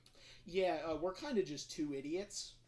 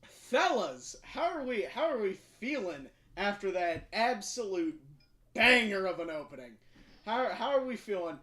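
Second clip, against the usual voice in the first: hyped greeting; 4 semitones higher, 9 dB louder than usual. Real, not acted. Hyped stream opening greeting.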